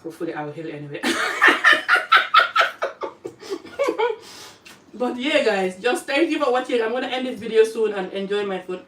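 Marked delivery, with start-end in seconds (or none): 1.1-4.5 high breathy giggle; high laugh. Real, not acted. Vlogger laughing about her healing journey, high airy laugh.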